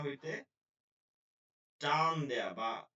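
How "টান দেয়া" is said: "টান" stretched long and louder; drawn-out vowel. Shown as a demonstration. Teacher explaining long vowels, stretched "টান". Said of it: "টেনে কথা বলা বা দীর্ঘ স্বর".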